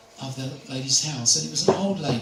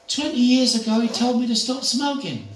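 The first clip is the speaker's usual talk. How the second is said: put-on old lady voice; 8 semitones higher, 5 dB louder than usual. Elderly lady voiced in story.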